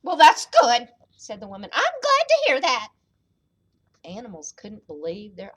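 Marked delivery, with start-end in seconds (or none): 0.0-0.9 put-on loud, raised little old lady; storybook old woman, raised voice; old mama voice. A narrator's reading. Old woman answers her son. 1.7-2.9 put-on high, quick old lady; reply as the giant's tiny mama; high-pitched storybook old woman. A narrator's reading. Old woman answers her son.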